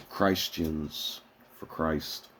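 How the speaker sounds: slow, drawn-out mock piety; mock solemn. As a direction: stretch it out with mock reverence. Mocking his friends.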